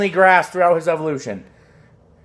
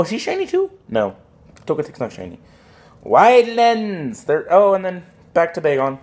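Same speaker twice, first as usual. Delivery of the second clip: ordinary talk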